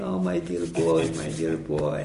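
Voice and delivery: a lightly voiced weeping aunt, understated; imitating a crying aunt. Aunt weeps over her condemned nephew, soft mock sobbing. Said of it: "the aunt is crying".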